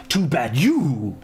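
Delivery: slow, drawn-out villain line; bad movie villain. Quoting Mortal Kombat Annihilation, slow hammy villain. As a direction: stretch "you", then drop to a whisper. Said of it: "Mortal Kombat Annihilation".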